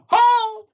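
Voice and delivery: very high, loud whoop; loud high cry in passing; shouted "ho". Acted. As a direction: holler it high as you fly by. Acting the rush past warships.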